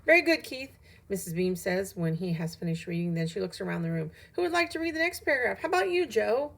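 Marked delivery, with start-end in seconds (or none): 0.0-0.7 high, loud teacher praise; teacher praising. A narrator's reading. Missus Beam praises Keith after reading, high loud teacher voice. 4.3-6.5 high, quick teacher voice; teacher calling on pupil. A narrator's reading. Missus Beam picks Joe to read, high teacher voice.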